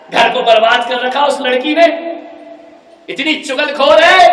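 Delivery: loud, high accusation; furious bride complaining; angry rant. Acted. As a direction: rail against the sister-in-law. Daughter insults sister-in-law.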